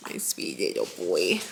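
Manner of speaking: soft low murmur to her son. Real, not acted. Mother to her baby, soft tender murmur. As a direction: coo it gently down low.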